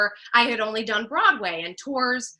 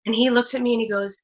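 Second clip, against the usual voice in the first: usual speaking voice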